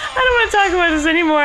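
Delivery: fast, laughing protest; giggling refusal to go on. Real, not acted. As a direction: say it quickly through laughter. Laughing over the wrong song.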